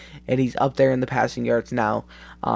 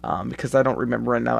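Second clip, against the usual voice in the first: usual speaking voice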